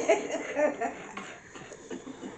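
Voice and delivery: airy giggle after the sneeze; breathy laugh. Real, not acted.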